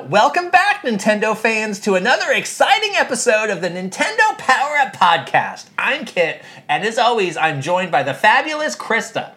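bright show-opening welcome; podcast host read. Acted. Reading a podcast script aloud.

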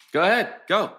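exasperated dare. Acted. Dares him to run, louder.

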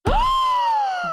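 sharp high gasp of shock; loud shocked gasp. Real, not acted. Cohost gasps loudly as the husband hangs up on her.

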